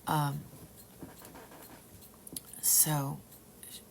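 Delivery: ordinary talk, nothing marked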